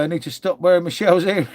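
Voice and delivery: ordinary delivery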